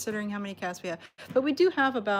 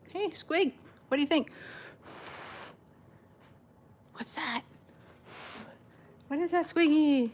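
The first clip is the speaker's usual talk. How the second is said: much higher curious sing-song; 8 semitones higher than usual. Real, not acted. Owner asking Squiggy what she thinks of the bubbles, high cooing voice. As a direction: tease the cat with a curious coo.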